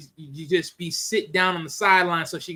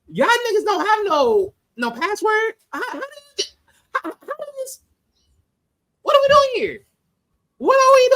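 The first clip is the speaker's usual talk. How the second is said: far higher and louder, near-crying incredulity; exasperated disbelief; 14 semitones higher, 4 dB louder than usual. Retold or imitated. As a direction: shriek at how dumb this is. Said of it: "I'm thinking to myself".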